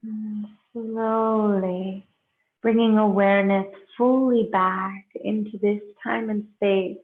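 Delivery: slow, unhurried meditation guide with long pauses; guided meditation. Real, not acted. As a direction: ease them into breath and stillness, leaving space between phrases.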